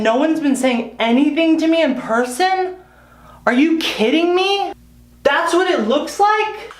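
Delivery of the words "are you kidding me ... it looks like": slow, higher disbelief at the bald spot; horrified surprise, slowed right down; real shock. Real, not acted. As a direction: drag out the stunned question.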